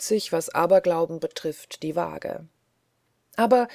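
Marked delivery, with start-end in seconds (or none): 3.4-3.7 a higher, louder burst; sudden call. Acted. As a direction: blurt it out all at once. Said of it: "rief Oki plötzlich".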